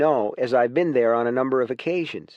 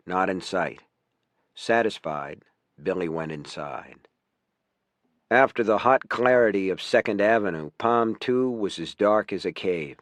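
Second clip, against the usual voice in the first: story narration; 5 semitones lower than usual. Acted. Author reading his sample scene to students, low narration. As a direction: read it like the opening of a novel, unhurried.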